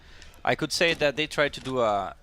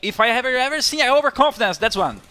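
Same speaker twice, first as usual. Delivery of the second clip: excited; 10 semitones higher, 8 dB louder than usual. Real, not acted. Excited outburst at bad play.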